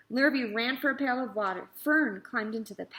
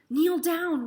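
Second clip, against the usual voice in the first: ordinary delivery, nothing marked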